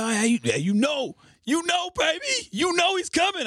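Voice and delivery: imitating Clipper Darrell, high-pitched; squeaky mock confidence, you know baby; high imitated hype voice. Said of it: "He's like".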